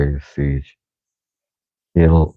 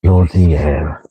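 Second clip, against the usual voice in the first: mock British accent, slightly low; put-on accent; 3 semitones lower than usual. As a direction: grumble in a fake British accent. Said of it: "you're just gonna get the accent".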